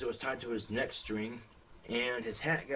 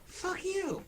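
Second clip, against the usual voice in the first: very high dummy voice cursing; dummy cursing at its owner, very high; puppet snap. Acted. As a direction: spit it back as the puppet. Dummy swears back at owner.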